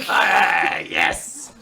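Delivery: rough, loud mock scream; celebrating. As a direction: let out a screamed cheer. Joins in the friend's celebration.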